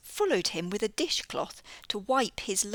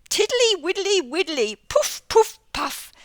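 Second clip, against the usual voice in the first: high, loud puffing toad voice; 9 semitones higher, 8 dB louder than usual. Acted. Mister Jackson the toad blowing thistledown all over the room. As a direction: puff it out like a silly toad.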